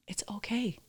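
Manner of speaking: whispered, gentle reassurance; soothing whisper. Retold or imitated. Wife comforting her guilty husband, a soft whisper. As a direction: whisper it to calm him down.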